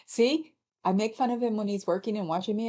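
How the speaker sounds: ordinary talk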